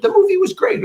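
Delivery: delighted, way up high; enthusiastic. Shown as a demonstration. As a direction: gush about the movie. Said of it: "you just go right into it".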